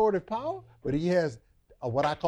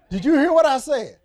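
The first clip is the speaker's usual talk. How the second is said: high, loud laughing scold; grandmother scolding through laughter; 7 semitones higher, 8 dB louder than usual. Retold or imitated. Preacher replaying grandmother's scold, laughing and loud. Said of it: "my grandmother".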